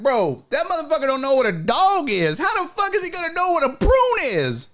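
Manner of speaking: high, incredulous rant; high exasperation. Retold or imitated. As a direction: squeal in disbelief.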